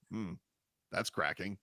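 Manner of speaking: a quiet, deadpan mock aside; imitating a player. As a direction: mumble it low like a player shrugging off a cracked bone. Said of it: "he goes to the sideline and goes".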